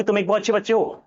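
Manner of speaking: fast version of the sentence. Shown as a demonstration. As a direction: rattle it off fast and high. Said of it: "तो मैंने तेज बोला".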